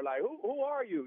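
imitated bewilderment, pitched high; retold friends' disbelief; taken aback. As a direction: stumble into a baffled question. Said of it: "they're all kinda like".

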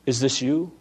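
ordinary delivery

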